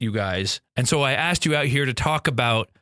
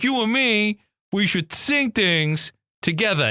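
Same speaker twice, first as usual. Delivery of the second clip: put-on New York accent; 6 semitones higher than usual. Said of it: "I got into my New York accent again".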